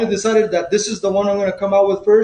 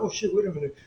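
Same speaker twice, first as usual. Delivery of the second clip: much softer, second thoughts dawning; sudden worried hush; 9 dB quieter, much faster than usual. Retold or imitated. Realizing the driver may have a gun, softer worried hush. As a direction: drop the voice as doubt creeps in. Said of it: "I was like".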